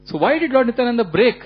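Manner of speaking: usual speaking voice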